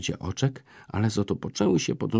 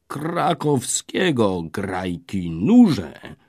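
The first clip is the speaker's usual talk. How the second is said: calling out to the band, louder on the end; shouted command; 2 semitones higher, 5 dB louder, much slower than usual. A narrator's reading. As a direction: bellow the order at the musicians. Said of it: "krzyknął na muzykantów".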